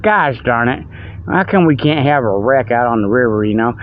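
normal talking voice

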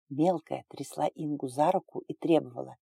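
ordinary delivery, nothing marked

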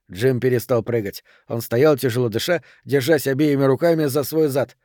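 ordinary delivery, nothing marked

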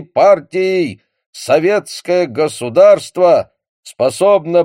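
loud, slow, high solemn radio speech; Marshal Voroshilov. Acted. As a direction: boom it out like a Soviet broadcast. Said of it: "торжественный голос маршала Ворошилова".

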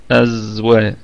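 ordinary delivery

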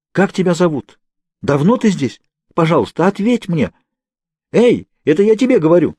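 high, loud urgent questions; calling out. A narrator's reading. Kate calling loudly to the silent Naomi. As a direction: demand an answer through the wall.

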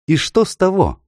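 high-pitched indignant retort. A narrator's reading. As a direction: shoot back, affronted, pitch leaping up. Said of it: "возмутилась незнакомка".

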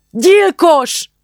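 a loud, high scream of accusation; furious shout. A narrator's reading. Julcsi screaming at the minister through the car window, furious shout. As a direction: hurl the word at the car window. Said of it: "és elkiáltotta magát".

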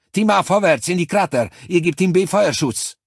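high, loud battlefield commands; shouted orders. A narrator's reading. Cortez orders the teams forward, loud commanding shout. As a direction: call the orders across the field.

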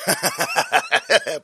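gleeful villain cackle; evil laugh. Acted. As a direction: cackle at the victims. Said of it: "заржал я".

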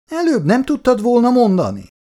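high-pitched, indignant reproach; put-out, high-pitched grumble; exasperated complaint. A narrator's reading. Woken father complains to daughter.

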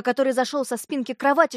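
usual speaking voice

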